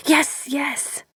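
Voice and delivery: a hint of whimper in an impatient yes. A narrator's reading. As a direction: brush it off impatiently. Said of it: "she said impatiently".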